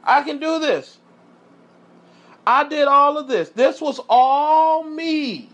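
high, loud, self-glorifying proclamation; Nebuchadnezzar exclaiming. Acted. Preacher as the king taking all credit, exclaiming powerfully. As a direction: boast it big, drawing out the last words. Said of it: "exclaimed is powerful".

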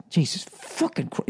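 hushed retold cursing; under the breath. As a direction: swear quietly to yourself, retelling it. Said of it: "under my breath going".

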